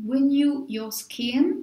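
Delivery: slow English product name in a French accent; self-mocking English accent. Real, not acted. Reading the serum's English name to her viewers, slow French-accented English. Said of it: "toujours avec mon superbe accent anglais".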